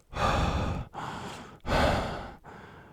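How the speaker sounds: loud, breathy puffs of exhaustion; heavy panting. A narrator's reading. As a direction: pant hard like racehorses after a run. Acts out panting like racehorses.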